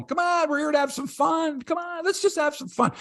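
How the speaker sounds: high, mocking cheerleader voice; hammed-up coach saying just have fun; mock pep talk. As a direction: send up the manager's breezy encouragement. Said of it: "it's great to say it when you're sitting at home".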